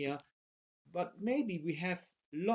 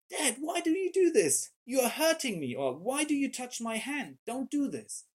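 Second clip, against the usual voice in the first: put-on much higher, louder hurt child's complaint; whiny toddler; 9 semitones higher, 4 dB louder than usual. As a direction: squeal like a toddler being held back. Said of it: "he will say something like".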